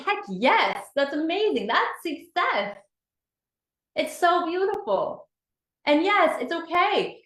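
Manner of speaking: high, delighted cheering; excited praise. Real, not acted. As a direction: lift them up with glee. Cheering a group member's answer.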